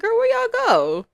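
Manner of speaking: high, loud, drawn-out mock call. Sim asking where her friends went, calling out. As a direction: holler it up high, stretching the words.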